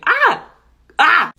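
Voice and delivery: sharp high yelp; a sudden surprised cry; startled yelp. Real, not acted. Sudden reaction to the test.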